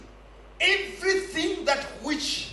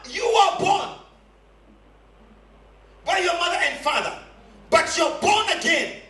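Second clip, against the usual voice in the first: emphatic preacher, landing key words hard; driving sermon delivery with weighty pauses; forceful preaching; 3 semitones higher than usual. Real, not acted. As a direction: proclaim it to the congregation.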